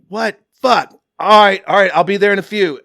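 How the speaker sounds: loud annoyed answer to a phone call; urgent phone answer. Retold or imitated. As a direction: bark it into the phone, rattled.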